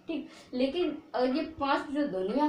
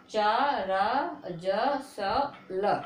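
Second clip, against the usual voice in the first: each syllable drawn out slowly, one by one; slow syllable recital; much slower than usual. Shown as a demonstration. Teacher lists sounds for students, slowly. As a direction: spell out चा, रा, ज, स, ल slowly for the class. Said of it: "अगर ये पांच ... ध्वनियाँ निकलें".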